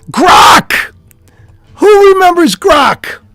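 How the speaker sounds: loud, high shout of the name; calling out. Real, not acted. Shouting to viewers about the AI, loud high call. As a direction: hail the crowd like an announcer.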